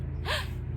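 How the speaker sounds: quick high gasp of delight; excited gasp. Acted. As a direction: gasp with wide-eyed wonder. Excited gasps between lines.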